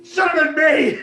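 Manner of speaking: anguished shout. Acted. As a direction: scream it at yourself. Scott's guilt bursts out.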